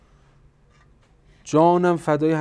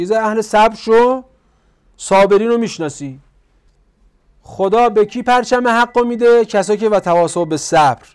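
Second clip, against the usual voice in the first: loud, higher-pitched sermon voice; raised, emphatic exhortation; forceful preaching; 5 semitones higher, 8 dB louder than usual. Real, not acted. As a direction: drive the point home to the congregation. Preaches the main point at force.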